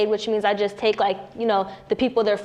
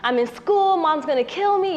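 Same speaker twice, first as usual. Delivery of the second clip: higher, panicked rush of words; teen girl panicking over a positive test; scared panic; 8 semitones higher than usual. Retold or imitated. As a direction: blurt it out in a frightened panic. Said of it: "You know, I was just scared".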